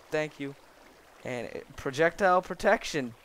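ordinary talk, nothing marked

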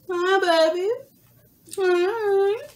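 high sing-song pet talk. Real, not acted. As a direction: coo at the cat. Greeting pets in baby talk.

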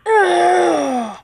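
a sudden loud scream of shock. Real, not acted. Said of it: "That was a scream".

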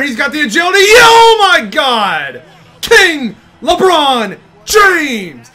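high, loud and stretched-out amazement; excited shout. Real, not acted. Gamer cheering LeBron's move, shouting in awe. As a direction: let the awe burst out. LeBron making a big play.

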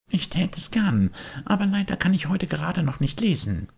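put-on high, longing puppet boy; a boy pulled toward the music; wistful high boyish voice; Bengele's voice. Bengele answers.

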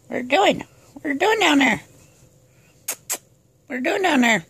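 high, sing-song voice for the cat. Real, not acted. Talking to the cat.

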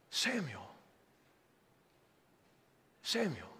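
a still small voice calling; soft calling voice. Acted. God's voice calling again.

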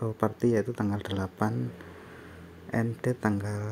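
ordinary delivery

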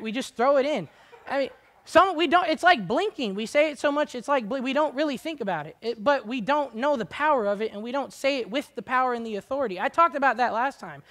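high, loud preaching push; fiery preaching. Real, not acted. Preacher rousing the congregation, loud and high. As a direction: drive it home from the pulpit.

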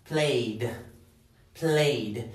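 "played" as one syllable, voiced d. Shown as a demonstration. Teacher modelling past tense, "played" in one syllable ending in d. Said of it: "esto se pronuncia".

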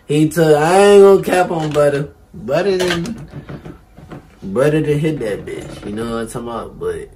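loud, rowdy and tipsy. Real, not acted. Drunk rapper hyped in studio.

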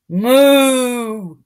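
loud, drawn cow moo. Acted. Mystery moo in story.

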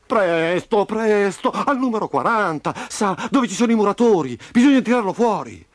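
put-on high, pressing child's voice; urgent insistence. A narrator's reading. Memo begging Roby's father to rescue him, urgent. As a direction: urge the grown-up to hurry.

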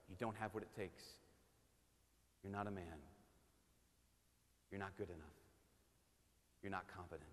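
quiet dark inner voice; hushed inner lie. Retold or imitated. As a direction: voice the lie in your head quietly.

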